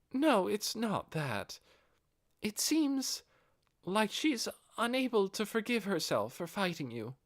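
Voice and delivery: a lightly voiced noble lady trailing off; devoted female retainer. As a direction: report it, then falter. Albedo explains Shaltir's guilt.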